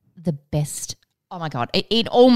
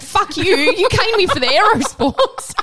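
much higher, louder mock retort; mock snap; 13 semitones higher, 6 dB louder than usual. Voicing the best friend's comeback, high and loud. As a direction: fire back as the best friend. And she was like.

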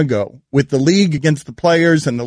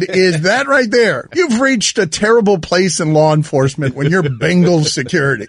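normal talking voice

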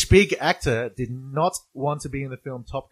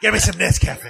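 gung-ho soldier; 4 semitones higher, 4 dB louder than usual. Pretend. Playful Rambo impression.

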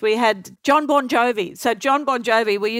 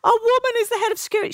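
much higher, gushing imitation, stretching "so cool"; Oprah impression, thrilled; 13 semitones higher than usual. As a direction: gush like a star who is genuinely impressed. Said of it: "She goes".

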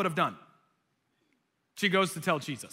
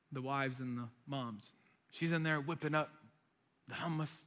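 ordinary delivery

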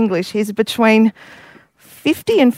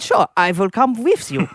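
a hint of a German accent; lightly put-on German accent. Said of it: "So you do the German".

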